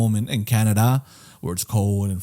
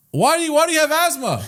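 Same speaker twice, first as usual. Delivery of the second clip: high mock question.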